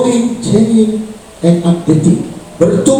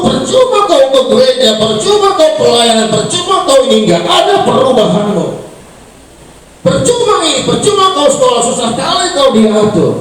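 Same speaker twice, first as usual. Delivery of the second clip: higher nagging parent's voice; complaining parent; 10 semitones higher than usual. Retold or imitated. Parent scolding a churchgoing child, higher nagging. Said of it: "Banyak orang tua mengeluh".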